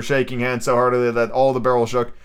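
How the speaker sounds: plain reading voice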